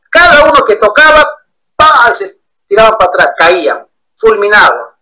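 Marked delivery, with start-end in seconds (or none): 1.8-2.1 sharp loud "pa" like a palm strike; mock slap sound. Pastor's hand hitting each believer, loud mock slap. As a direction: smack the word out.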